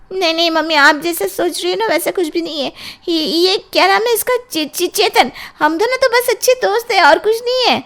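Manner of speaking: put-on very high, pleading girl's voice; high-pitched, stammering denial to mother; flustered daughter. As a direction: nervously explain yourself, stumbling over words.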